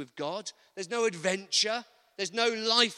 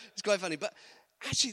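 ordinary talk, nothing marked

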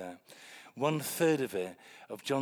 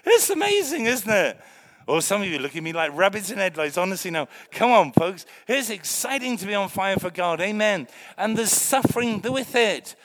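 much higher and louder, testifying with fire; fiery preaching; 6 semitones higher, 9 dB louder than usual. Acted. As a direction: preach it out to the congregation. Preaching at full force.

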